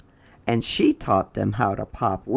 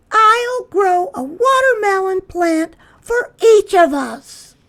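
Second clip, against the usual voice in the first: very high, louder put-on voice; Lily's voice; 8 dB louder than usual. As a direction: pipe the line way up high. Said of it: "she said".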